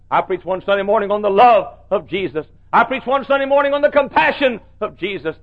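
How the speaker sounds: rhythmic preacher's litany, rising in pitch; preaching cadence. Acted. Preacher recalling his sermon series, building cadence. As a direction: hammer each line like a building sermon.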